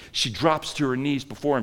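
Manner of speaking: ordinary talk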